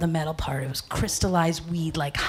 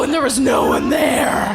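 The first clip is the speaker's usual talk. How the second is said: ordinary delivery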